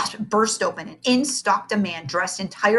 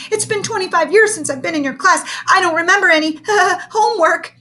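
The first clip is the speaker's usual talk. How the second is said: nervous high voice; 12 semitones higher, 6 dB louder than usual. Acted. Nervous excuse to teacher.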